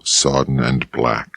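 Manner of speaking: ordinary delivery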